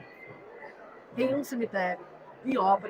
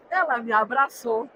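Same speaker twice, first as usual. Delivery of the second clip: ordinary talk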